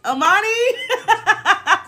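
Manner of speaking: high, very slow, laughing right; laughing drawn-out call. Real, not acted. Laughing callout to Amani in the chat. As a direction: stretch it out through a laugh.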